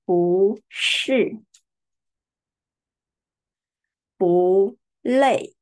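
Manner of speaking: 不是 and 不累 with 不 rising, second tone; bu shi, bu lei demo. Shown as a demonstration. Teacher recapping rising 不 before fourth tones. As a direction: say 不 rising before each falling word. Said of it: "we pronounce second tone".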